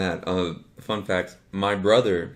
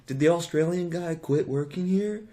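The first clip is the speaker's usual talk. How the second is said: high, plaintive put-on voice; wistful customer; 9 semitones higher than usual. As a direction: ask it like you miss him terribly. Voicing a wistful drive-thru regular.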